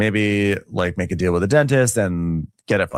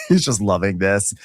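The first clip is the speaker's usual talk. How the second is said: usual speaking voice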